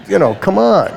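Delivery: high, scoffing disbelief; retold scoff at agents pitching a video; incredulous come on. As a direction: brush off an absurd offer, pitch up. Disbelief at FBI video offer.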